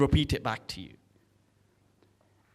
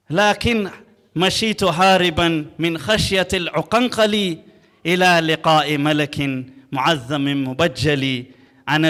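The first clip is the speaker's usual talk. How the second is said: poem recitation; 5 dB louder, faster than usual. Acted. Bedouin poet recites his tongue-twister poem before the caliph, building to a loud fast chant. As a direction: recite it rhythmically, picking up speed and volume as it goes.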